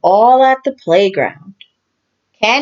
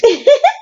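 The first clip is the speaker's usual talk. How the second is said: squeaky rabbit giggle; very high short giggle. A narrator's reading. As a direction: giggle like a little girl. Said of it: "Rebecca laughs".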